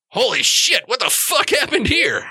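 high-pitched, drawn-out amazement; astonished orc. Acted. Orc walks up and sees the wreckage, astonished. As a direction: play stunned disbelief, pitch jumping up.

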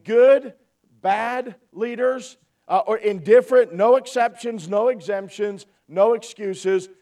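slow, emphatic, spaced-out delivery; deliberately slow. Shown as a demonstration. Preacher repeating the point to the folks, slowly and emphatically. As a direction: say it slowly, punching each item with pauses between. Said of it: "I want to say this slowly".

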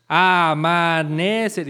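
amanecer drawn out as a-ma-ne-cer; school-exercise syllable split of amanecer; syllable-by-syllable demonstration. Shown as a demonstration. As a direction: say amanecer very slowly, one syllable at a time. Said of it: "había un ejercicio que hacían en la primaria".

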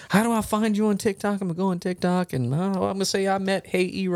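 imitated gushing fan, pitched high; enamored, excited. Old couple gushes over TikTok golfer, high excited imitation. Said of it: "all enamored about it and excited".

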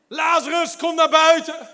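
shouting Lazarus's name again, high and loud; loud call. Acted. Preacher re-enacting Jesus calling Lazarus out, loud shout. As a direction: call into the grave with full force. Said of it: "riep met luide stem".

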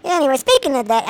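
ordinary delivery

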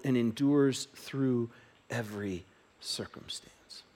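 hushed, slow reading of the verses; soft scripture reading. Acted. Pastor reading the love passage to church, hushed and slow. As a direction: read it gently, letting each phrase land.

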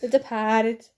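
mock Boston accent. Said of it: "That's not even a Boston accent".

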